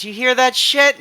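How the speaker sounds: high-pitched incredulous question; can't believe what he just heard; surprised. Acted. Clocks reacts to news.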